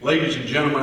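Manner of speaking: ordinary talk, nothing marked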